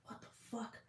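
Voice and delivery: breathless whispered what the fuck; a stunned whisper at a soaked shirt; shocked whisper. Retold or imitated. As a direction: whisper it in disbelief. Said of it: "And I was like".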